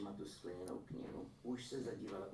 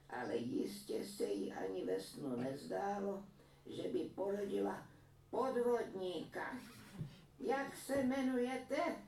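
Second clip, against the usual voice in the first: higher, louder, accusing granny; scolding old woman; 12 semitones higher, 5 dB louder, slower than usual. A narrator's reading. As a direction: expose the fraud to his face. (stařenka hlasitě usvědčuje podvodníka)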